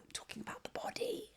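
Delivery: whispered as if sharing a secret; hushed reveal. Retold or imitated. Retelling Jesus, she drops to a whisper on the body. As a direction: breathe it out, voiceless and soft.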